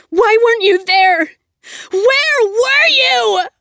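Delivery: high, loud crying shout, drawn out slowly; crying rage. Acted. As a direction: sob the accusation at him. Confronting the man who failed her.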